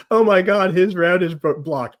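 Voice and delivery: high, loud imagined player's dismay; hammed-up "oh my God" panic, high-pitched; mock alarm. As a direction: act out a player suddenly alarmed. Acting out a worried player's reaction.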